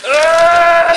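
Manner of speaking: loud, high, drawn-out "ugh"; frustrated groan. Real, not acted. Cookies get stuck in blender.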